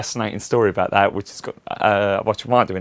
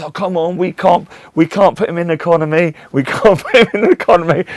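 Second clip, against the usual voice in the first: high, pushy persuasion; mock the friend coaxing for cash; wheedling Charles; 8 semitones higher, 5 dB louder than usual. Charles voiced pleading for money.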